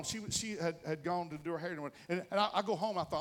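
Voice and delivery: ordinary talk, nothing marked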